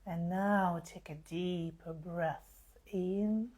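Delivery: slow, spacious meditation voice with long pauses; guided meditation. Acted. Healer easing live listeners into relaxation under the full moon, slow guided meditation. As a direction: guide them gently into relaxation, unhurried.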